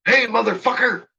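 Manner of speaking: hammed-up confrontational yell, rising sharply in pitch; irate girlfriend shout. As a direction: impersonate an outraged woman confronting a guard. Girlfriend storms out to confront border guard.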